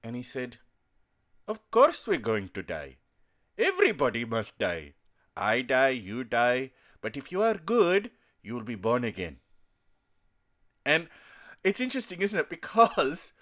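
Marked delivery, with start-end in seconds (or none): 1.5-3.0 imitated Indian accent, lower-pitched; mock Indian-English answer, pitched down; uncle's Indian accent. Uncle answering a relative's question, in Indian accent. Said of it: "start to speak like that". 3.6-9.4 imitated Indian-English uncle; uncle's Indian accent. Uncle explaining death and rebirth, in Indian accent. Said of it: "start to speak like that".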